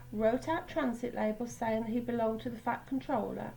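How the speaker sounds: ordinary delivery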